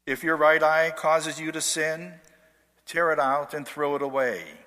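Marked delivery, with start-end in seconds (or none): none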